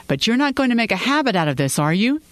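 husband teasing wife about working late, half joking. Acted. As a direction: tease with an edge of truth. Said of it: "he teased".

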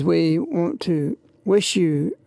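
ordinary delivery

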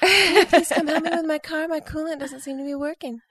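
put-on high, sweet Southern Belle accent. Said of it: "a Southern Belle accent".

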